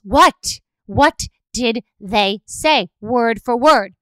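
loud, slow, word-by-word demand; insistent interrogation. Retold or imitated. Acted interrogation for every word.

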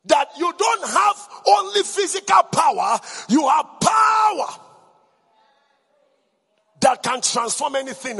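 loud rousing preacher declaring over music; full-force preaching. Acted. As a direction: proclaim it like thunder to the congregation.

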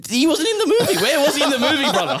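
high, loud protest through laughter; laughing outrage. Real, not acted. Player disputing a voice-only film role with his rival, laughing outrage. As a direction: protest incredulously, pitch shooting up with laughter.

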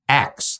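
"ask" flipped to sound like "axe"; "axe" in place of "ask"; mispronounced "ask". Shown as a demonstration. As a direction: say "ask" with the s and k swapped, as "aks". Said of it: "another word was A S K".

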